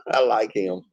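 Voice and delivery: normal talking voice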